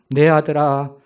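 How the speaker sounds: father weeping over his son's suffering; loud wailing. Acted. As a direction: wail for the son, peak on the third call, then fade. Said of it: "크게 울부짖기를 시작합니다".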